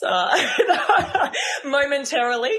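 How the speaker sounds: bursting into high laughter; real laugh. Real, not acted. Podcaster joking about red wine, laughing. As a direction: laugh at your own joke.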